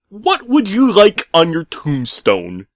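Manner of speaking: high, slow sarcastic voice; drawn-out sarcastic. Acted. Creepy pizza man asking at the door, slow and sarcastic. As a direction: stretch it out with mock menace. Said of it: "he asked in a drawn-out manner".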